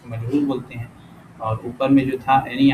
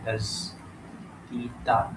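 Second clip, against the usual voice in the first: very slow, softer دزدیدہ in separate syllables; sounded out slowly; 9 dB quieter, much slower than usual. Shown as a demonstration. Sounding out the answer word.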